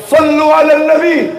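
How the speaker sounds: high-pitched rallying cry; acted shout. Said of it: "satu orang teriak".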